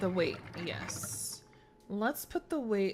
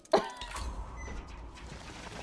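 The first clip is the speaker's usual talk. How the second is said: high breathless laugh; 5 dB quieter than usual. Real, not acted. Laughs after discovery.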